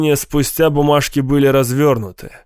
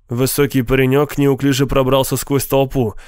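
ordinary delivery, nothing marked

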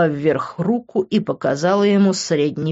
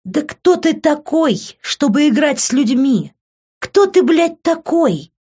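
much higher and louder, furious; outraged yell; 10 semitones higher, 3 dB louder than usual. A narrator's reading. Liv screams at Vincent who played with her. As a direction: hurl the question at him.

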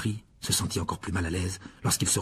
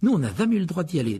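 put-on higher boyish voice with envy; envious; 7 semitones higher than usual. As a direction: say it with envious longing. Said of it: "dit Ron avec envie". (ton envieux)

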